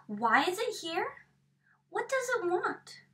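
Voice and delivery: ordinary delivery, nothing marked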